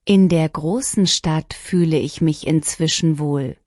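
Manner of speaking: ordinary talk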